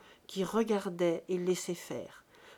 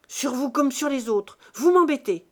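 much louder and higher, exasperated; menacing shout; 4 semitones higher, 11 dB louder than usual. A narrator's reading. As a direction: yell the threat at your father. Buteau threatens his father. (menace hurlée)

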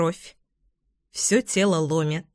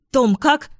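higher, louder urgent call; 5 semitones higher, 4 dB louder than usual. A narrator's reading. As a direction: call out to him in a rush. Bursting in after him.

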